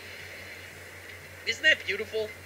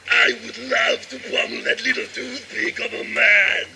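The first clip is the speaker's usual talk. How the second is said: crazy anime villain. Acted. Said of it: "I sound like some crazy anime villain".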